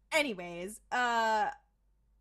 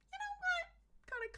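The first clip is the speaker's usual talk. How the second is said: very high, slow, softer voice; mock-sassy squeak; squeaky mock retort; 6 dB quieter, much slower than usual. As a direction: stretch it out in a tiny high pitch. Acting out retort to pagan gods.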